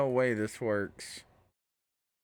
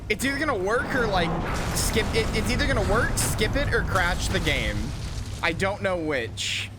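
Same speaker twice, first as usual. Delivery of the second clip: loud, high, tense shouting; excited shouting; 7 semitones higher, 8 dB louder, faster than usual. Real, not acted. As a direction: shout it out, wound up tight.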